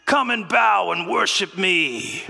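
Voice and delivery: slow, grand villain voice; slow, lordly demand for worship; imperious god-king, drawn out; Loki's command. Retold or imitated. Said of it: "and he's saying".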